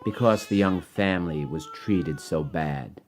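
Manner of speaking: measured rhyming storybook read over music; story narration. Acted. Author reading his rhyming book to children, gentle storytelling. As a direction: read it like a bedtime picture book.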